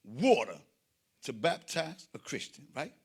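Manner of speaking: ordinary delivery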